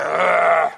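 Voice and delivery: mock disgusted shouted grunt; hammed-up exasperated ugh. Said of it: "you're not like".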